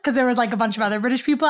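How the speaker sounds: ordinary delivery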